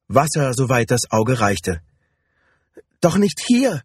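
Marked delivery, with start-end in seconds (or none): 3.0-3.8 high, alarmed protest; frightened woman objecting; panicked. A narrator's reading. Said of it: "sagte sie ganz panisch".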